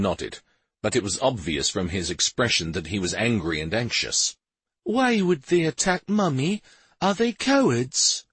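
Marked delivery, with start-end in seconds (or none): 4.8-8.3 lightly voiced upset boy; child voice. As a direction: ask like a worried little boy. Young son asks his father.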